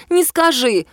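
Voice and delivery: protesting with a mouth full. Acted. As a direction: talk round a mouthful of food. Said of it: "с набитым ртом возразила Лиза".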